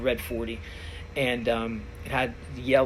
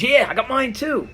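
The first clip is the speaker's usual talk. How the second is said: high, keen yeah, chiming in; eager buddy; 11 semitones higher, 6 dB louder than usual. Retold or imitated. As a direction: pipe up to match his friend. Buddy joins in bragging.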